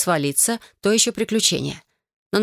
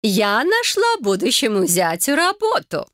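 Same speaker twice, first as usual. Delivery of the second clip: slow, higher, ceremonious proclamation; solemn announcement; 5 semitones higher, slower than usual. A narrator's reading. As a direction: announce it like grand news. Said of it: "торжественно произнесла Патриша Герас". (торжественно, медленно)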